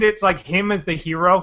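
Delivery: usual speaking voice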